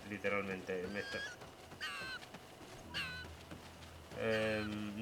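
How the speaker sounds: sleepy, drifting off mid-sentence; nodding off. Real, not acted. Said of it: "me estoy quedando dormido".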